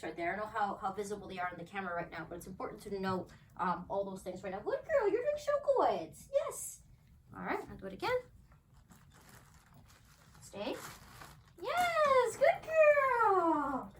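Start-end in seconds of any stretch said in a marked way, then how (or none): 4.6-6.8 much higher, louder sing-song praise; high dog praise. Real, not acted. Praising dog during training. 11.6-13.9 squealing praise to a dog; excited praise. Real, not acted.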